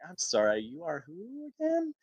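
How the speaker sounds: mock-polite, rising on again; feigned ignorance. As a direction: pretend you forgot her. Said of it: "I had to do it".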